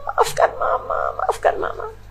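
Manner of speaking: whispered, broken apology; tearful whisper. Retold or imitated. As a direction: breathe the words out through tears. Mother whispers apology, weeping. (berbisik lirih sambil menangis)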